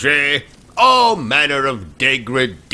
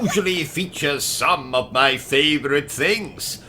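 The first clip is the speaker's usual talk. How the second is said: put-on British accent; 4 semitones higher, 4 dB quieter than usual.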